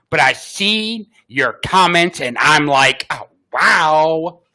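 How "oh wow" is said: put-on Valley Girl voice. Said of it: "did I do a good voice there".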